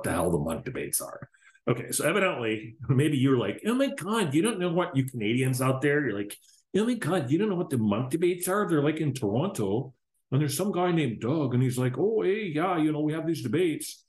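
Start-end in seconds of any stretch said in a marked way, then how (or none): 3.7-14.0 put-on higher-pitched comic Canadian accent; mock Canadian accent. Podcaster imitating Canadians explaining the Munk debates, mock Canadian accent. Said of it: "I don't know what kind of accent that was".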